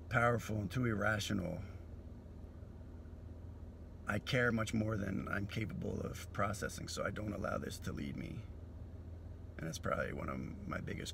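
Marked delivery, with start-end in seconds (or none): none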